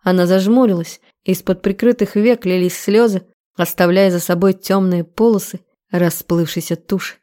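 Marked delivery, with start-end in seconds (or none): none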